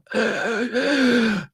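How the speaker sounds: high, drawn-out shocked gasp; imitated gasping. Orderly hearing life in dead man, startled gasping.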